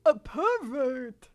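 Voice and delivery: slow, halting celebrity impression; Christopher Walken. Manga girl accusing a starer, slow Christopher Walken voice. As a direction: stretch it out like a famous actor's drawl. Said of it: "we're going to Christopher Walken".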